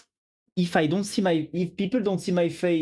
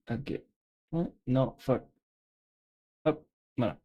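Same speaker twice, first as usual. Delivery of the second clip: normal talking voice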